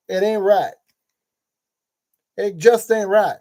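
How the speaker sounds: lightly voiced Texan drawl, Hank Hill style; a hint of Texan drawl, ain't right; Hank Hill southern drawl. Builder slipping into a Texan drawl mid-tutorial. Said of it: "turning it a lot into Hank Hill".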